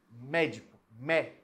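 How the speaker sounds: "Médico", then its first syllable "Mé" alone; single out the stressed MÉ of "Médico". Shown as a demonstration. Teacher showing the strongest syllable to students, stress on "Mé". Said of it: "Mé é a sílaba mais forte".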